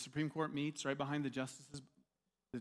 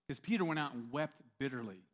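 usual speaking voice

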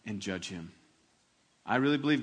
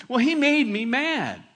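put-on child's excuse; 9 semitones higher, 7 dB louder than usual. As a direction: whine like a young kid blaming his brother. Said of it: "they'll say things like that".

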